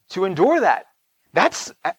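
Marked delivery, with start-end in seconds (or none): none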